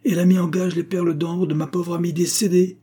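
high, accusing outburst; vindictive. A narrator's reading. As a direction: press the charge spitefully. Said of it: "s'écria mademoiselle Lyle d'un air vindicatif".

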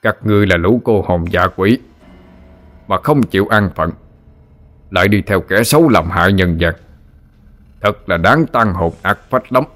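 resounding stern voice, slowing down; booming like thunder. A narrator's reading. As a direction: let it ring out like thunder. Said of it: "bằng một chất giọng vang vang như sấm".

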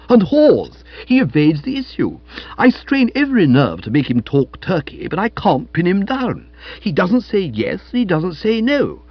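high-pitched exasperated tirade; explosive heat. A narrator's reading. Aunt resuming her rant after nephew's interruption, exasperated. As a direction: burst out loud, then keep fuming. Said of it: "speaking with the explosive heat".

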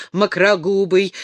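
plain reading voice

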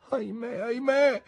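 high despairing wail. A narrator's reading. Emily laments her fate, wailing. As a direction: cry out in grief. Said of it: "piangeva la sventurata Emily".

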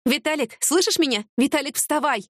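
high, urgent wake-up call. Acted. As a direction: rouse him, insistent and high. Waking injured man urgently.

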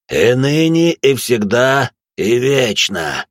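rasped prayer, slowing at the end; hoarse. Acted. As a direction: wheeze it out, spent. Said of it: "прохрипел отец Марк".

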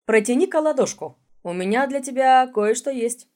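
coaxing. Acted. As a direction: coax him like a child. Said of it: "вкратчиво попросил".

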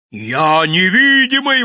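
high, loud, long-drawn howl; wailing yell. A narrator's reading. Naked madman insisting to an angry crowd, wailing yell. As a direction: wail it out slowly. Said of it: "вопил голый".

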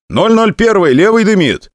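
high, loud alarm call; urgent radio warning. Acted. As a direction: warn the commander his engine smokes. Warning commander of smoking engine.

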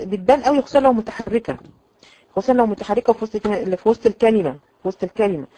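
ordinary delivery

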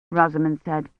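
ordinary delivery, nothing marked

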